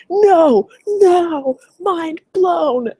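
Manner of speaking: very high, loud, stretched-out American amazement; hammed-up mind-blown reaction, every word held; expressive disbelief. Coach imitating the American guy seeing her conversions, hammed-up astonishment. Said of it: "Expressive, probably. And he was just like".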